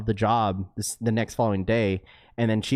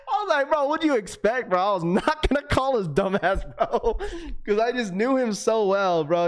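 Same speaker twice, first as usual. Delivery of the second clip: high, laughing retelling; words bubbling with laughter; 9 semitones higher than usual. Real, not acted. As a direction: still laughing at the friend. Laughing while retelling the call.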